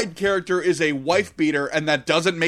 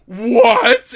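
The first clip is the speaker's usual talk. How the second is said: sudden high, loud what; shocked principal; 11 dB louder than usual. Retold or imitated. Principal stunned by the naked drawing, high loud shock. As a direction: squawk it in disbelief. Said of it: "And the principal's like".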